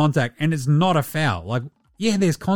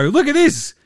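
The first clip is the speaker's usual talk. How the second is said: high, louder game-show presenter voice; hammed-up kids' show host; mock showman; 6 semitones higher, 3 dB louder, slower than usual. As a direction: sell the star to the kids like a TV host.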